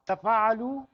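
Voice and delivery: तफ़ाअलु called out loud with a rising end; drill word. Shown as a demonstration. Teacher drills students on तफ़ाअलु, loud rising call. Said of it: "आप बार बार कहें".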